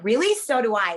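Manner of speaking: high, loud mocking "really?"; sarcastic retort. Woman retelling snapping back at a pickup line, mock sarcastic. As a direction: throw it back at him with mock disbelief.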